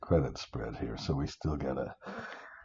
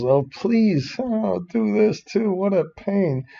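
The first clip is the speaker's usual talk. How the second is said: pitched way up, fed-up whine; exasperated groan; 12 semitones higher, slower than usual. Real, not acted. Teacher fixing merged cells, exasperated higher whine. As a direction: sigh it out like a chore.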